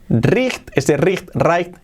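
"Riecht" read as spelled, with a harsh ch; deliberately wrong "Riecht", rising; "Riecht" as a spelling pronunciation; mock spelling-based reading. Shown as a demonstration. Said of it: "la pronunciación es right".

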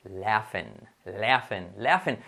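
"laughing" as "LA-fen", F sound; correct pronunciation. Shown as a demonstration. Teacher drilling the right form, correct pronunciation. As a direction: slowly model "laughing" with open A. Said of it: "No, va a ser".

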